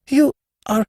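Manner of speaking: high, stunned stammer. A narrator's reading. Levantine to the fat man, stunned high stammer. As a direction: choke on the words in disbelief. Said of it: "He stammered".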